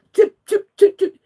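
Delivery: choked, furious stutter at the pastor; angry stammer. Real, not acted. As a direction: voice cracking high and loud with anger.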